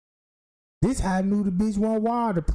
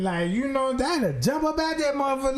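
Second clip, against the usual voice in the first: ordinary talk, nothing marked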